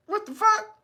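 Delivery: high, incredulous what the fuck; stunned, voice jumping up; disbelief. Real, not acted. Vlogger stunned by the weed conviction, high disbelief.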